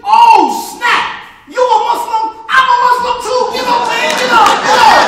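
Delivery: loud excited yelling; excited shout. Acted. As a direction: shout it with sudden joy.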